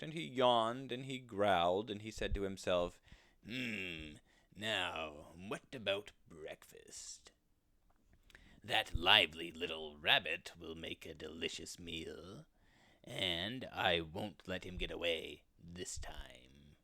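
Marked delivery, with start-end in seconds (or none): 3.5-12.5 sly weasel. Acted. Weasel plans his breakfast. 13.0-16.8 slow, scheming whisper; sneaky whisper. Acted. Weasel plots, sneaky.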